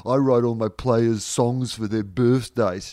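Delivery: smug mock coach voice, lightly put on; a teasing half-impression of a self-satisfied coach; mocking Alistair Clarkson. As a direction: send up the rival coach bragging. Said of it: "I'm Alistair Clarkson".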